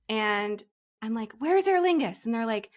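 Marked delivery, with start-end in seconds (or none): 1.4-2.3 frantic asking. Retold or imitated. Asking at next terminal.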